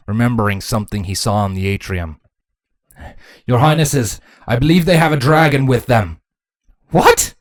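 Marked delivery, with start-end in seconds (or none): 3.5-6.2 louder, higher soldier's alarm; raised, worried voice; urgent warning. Acted. Captain warns of a dragon. 6.9-7.4 very high, loud outburst; shrieked one-word shock; shocked shout. A narrator's reading. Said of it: "both royals shouted".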